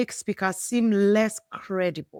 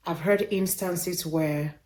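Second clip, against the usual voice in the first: ordinary talk, nothing marked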